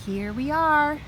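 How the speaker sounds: ordinary delivery, nothing marked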